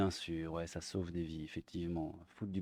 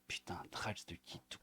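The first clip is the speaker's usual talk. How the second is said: whisper; 4 semitones lower, 17 dB quieter than usual. Real, not acted. Disbelief at the article.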